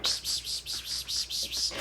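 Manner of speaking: unvoiced hissing pss sounds; whispered pss pss. Girlfriend nagging in his ear, soft hissing pss. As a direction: hiss softly like someone nagging in an ear. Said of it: "your girl fucking is in your ear".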